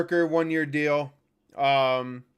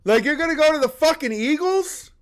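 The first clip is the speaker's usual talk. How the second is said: much higher, incredulous outrage; angry rant; 9 semitones higher than usual. Real, not acted. Fan berating absent player for betrayal, outraged. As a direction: lay into him, indignant and high-pitched.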